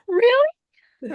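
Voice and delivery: very high, loud incredulous "Really?"; mock disbelieving child; doubtful child. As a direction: squeak it like a skeptical kid. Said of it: "she is kind of like".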